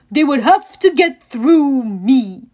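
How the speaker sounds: loud, slow menacing growl; growled threat. A narrator's reading. Cyclops growling a threat to Grover. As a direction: warn him off with a growl. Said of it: "Polyphemus growled".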